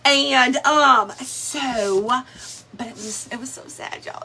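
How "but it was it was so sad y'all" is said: whisper. Real, not acted. Grieving the pet.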